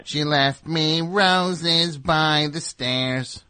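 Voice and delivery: slow, thin reedy singing. Pretend. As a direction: croon it thin and reedy, drawn out. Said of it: "The reedy voice".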